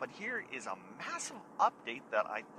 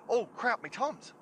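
sudden realisation; 9 semitones higher, 5 dB louder than usual. Retold or imitated. Suddenly remembering his Tums.

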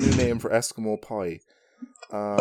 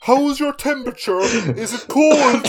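high, louder, drawn-out Eskimo caricature; hammed-up accent, pitched way up; mock Eskimo accent; 10 semitones higher, 4 dB louder than usual. Co-host quizzing as an Eskimo, mock accent.

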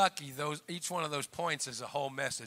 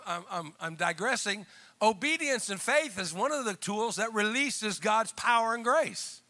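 ordinary delivery

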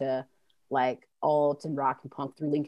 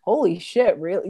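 surprised; 4 semitones higher, 6 dB louder than usual. Retold or imitated. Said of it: "And it was like".

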